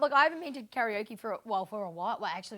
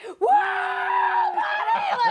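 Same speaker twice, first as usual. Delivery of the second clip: high, loud whoop; mock party cheer; 9 dB louder, much slower than usual. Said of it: "we were like".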